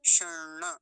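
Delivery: शर्ण loud, the first ण only touched; शर्ण as "shan-na", tongue curled back; double ण. Shown as a demonstration. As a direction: hold a retroflex n before the final vowel of शर्ण. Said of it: "पहले वाले का थोड़ा सा ही आ पाएगा".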